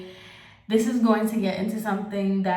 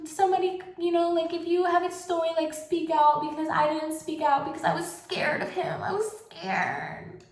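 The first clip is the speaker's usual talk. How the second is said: higher, sing-song mock sympathy; mocking fake concern; 8 semitones higher than usual. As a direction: parody hypocrites in a raised whiny voice. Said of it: "you wanna be like".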